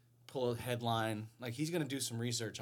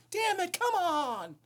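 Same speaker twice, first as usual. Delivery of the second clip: high, slow, hammed-up exasperation; mock frustration; 6 dB louder, slower than usual. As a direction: melodramatic whine.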